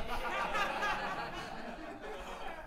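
smug high laugh. Acted.

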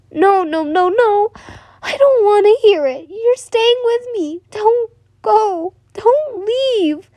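high-pitched frantic refusal; desperate shout. Acted. Boy clinging to her nightgown refuses to let go, desperate high shout. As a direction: cry out in a high, drawn-out plea. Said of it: "he shouted".

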